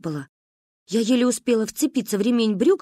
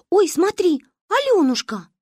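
high, loud excited call; excited spotting; 10 semitones higher, 4 dB louder than usual. A narrator's reading. Manka excitedly points out the girl to her friend.

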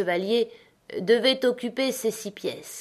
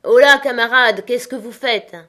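loud hail across the deck, louder; shouted call; 4 semitones higher, 10 dB louder than usual. A narrator's reading. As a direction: call out to the crew, loud and high. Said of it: "Il s'écria".

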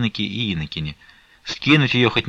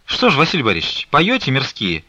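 higher, coaxing question; persistent pestering; 5 semitones higher than usual. A narrator's reading. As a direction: nag him playfully for an answer. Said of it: "приставала Флёнушка".

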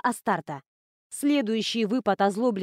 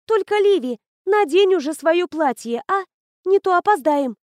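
loud, high, hurrying a friend; urging call; 8 semitones higher, 6 dB louder than usual. Acted. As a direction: call out, impatient. A girl hurries her friend.

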